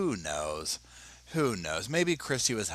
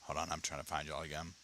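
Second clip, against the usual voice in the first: ordinary delivery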